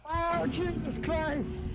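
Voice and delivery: high drawn-out startled cry. Real, not acted. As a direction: cry out in fright. Scared by monster.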